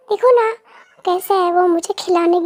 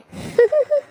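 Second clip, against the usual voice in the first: high little giggle; a squeaky amused giggle; 10 semitones higher than usual. Acted. Laughs at failed crawling. (ऊँची हँसी)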